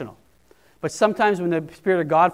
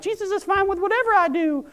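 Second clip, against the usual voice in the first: careless believer; 13 semitones higher than usual. Retold or imitated. Preacher mocks a careless believer, high mocking voice. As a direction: squeak it out like a smug rule-breaker.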